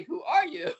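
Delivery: throwing the question back at him; retold cheeky retort.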